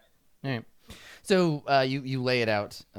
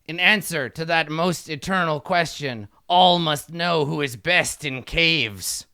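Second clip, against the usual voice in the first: grandiose kobold king; 3 semitones higher, 5 dB louder than usual. Acted. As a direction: boom it out like a tiny tyrant. King recites sacred question.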